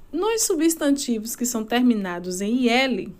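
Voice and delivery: normal talking voice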